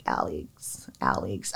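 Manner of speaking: breathy voiceless whisper of his name; eerie whisper. Retold or imitated. Recalling a haunting voice calling his name, in an eerie whisper.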